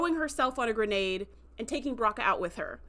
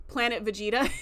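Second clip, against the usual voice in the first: ordinary delivery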